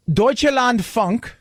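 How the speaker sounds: slow mock German pronunciation; exaggerated German accent, slow and drawn out. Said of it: "you spoke with a German accent".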